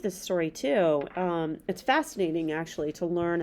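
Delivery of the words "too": ordinary delivery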